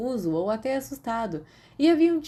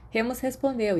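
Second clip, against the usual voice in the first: usual speaking voice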